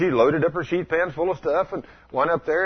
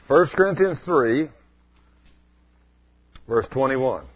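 ordinary talk

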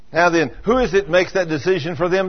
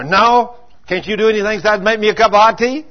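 loud, higher mock-irritated husband; grumpy retort; 6 semitones higher, 6 dB louder than usual. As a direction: snap at her, half laughing. Husband snaps grumpily at wife.

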